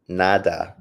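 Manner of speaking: ordinary talk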